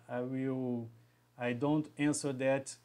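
ordinary delivery

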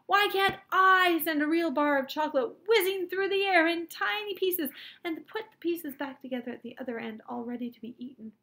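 loud, high-pitched insistent argument; shouted. A narrator's reading. Boy arguing chocolate could be sent like photographs, shouted. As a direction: push it out loud and high, easing off at the end. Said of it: "I shouted".

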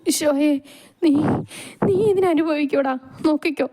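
high, strained, broken words; dying in pain. Acted. Dying mother curses the son who kicked her, in pain. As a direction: gasp out a last curse, halting.

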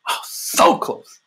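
slow breathless whisper of awe; awed hush. Real, not acted. Preacher marvelling at how close the Father is, slow awed whisper. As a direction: marvel at it under your breath.

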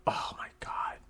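soft unvoiced whisper; breathless whisper. Real, not acted. Player stunned after the pogo round, breathless whisper. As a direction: whisper it in disbelief.

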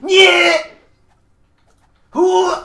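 high, very loud victory shout; triumphant yell. Real, not acted. Gamer yells in triumph mid-fight, very loud shout. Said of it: "Sorry that I'm yelling".

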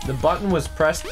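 normal talking voice

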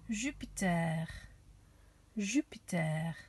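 "Jupiter" in French, zhü-pee-TAIR; French accent. Shown as a demonstration. Teacher showing children how to say "Jupiter" with a French accent. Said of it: "bit of a French accent".

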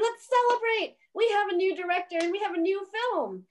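loud, high party cheer; mock celebration. Officials toasting the new director, loud high cheer.

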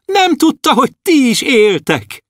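high dwarf voice calling out; shouting dwarf. Acted. Dwarf shouting to the stars about Andris, high. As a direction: call it up to the stars, high and long.

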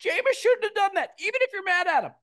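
very high, whiny fake-sorry voice; mocking apology. Host mocking the coach's groveling sorry, very high and whiny. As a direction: ham up a groveling, simpering apology. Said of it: "It's like".

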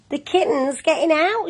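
high-pitched storybook shout; shouted alarm. A narrator's reading. Missus Thomas warns that the kitten's escaping, mock shout. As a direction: raise the pitch as if warning everyone. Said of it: "shouted Missus Thomas".

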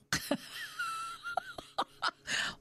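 high bursting laugh at herself; amused laugh. Real, not acted. Host laughing at her own urge for heads to roll, high and bright. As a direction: laugh it off at your own grumpiness.

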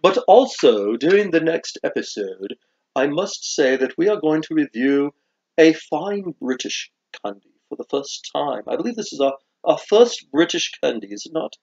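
put-on British accent, lilting formality. Host previews British candy for viewers, put-on British accent. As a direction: announce it like a proper British presenter. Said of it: "how they would mock my British accent".

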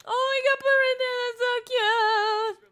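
high, loud gushing; delighted squeal. Real, not acted. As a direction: gush over something adorable. Delighted by dancing toddler.

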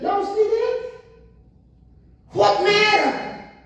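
high, astonished questions; amazed disciples. Retold or imitated. Amazed disciples after storm stops.

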